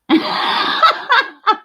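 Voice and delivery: airy high giggle; breathy high laugh. Real, not acted. Laughing after puppies.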